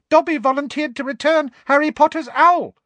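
very high, loud squeaky elf voice; squeakily. A narrator's reading. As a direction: squeak it with eager pride. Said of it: "said the elf squeakily".

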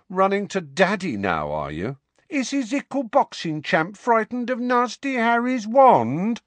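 high, loud, sing-song taunt; mock him like a baby; mocking baby talk.

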